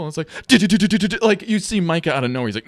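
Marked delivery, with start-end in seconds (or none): 0.5-1.2 loud, high burst of rapid syllables; rapid-fire stutter noise. Retold or imitated. Describing a quarterback's scramble to co-host, imitated stutter noise. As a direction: imitate frantic scrambling feet.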